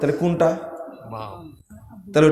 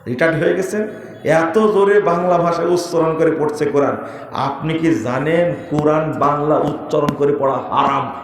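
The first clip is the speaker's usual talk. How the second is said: ordinary talk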